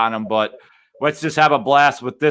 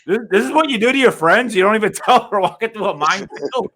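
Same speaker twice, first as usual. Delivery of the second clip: incredulous, higher, laughing; mock outrage; 7 semitones higher than usual. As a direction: scold a friend in disbelief. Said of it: "You're just like".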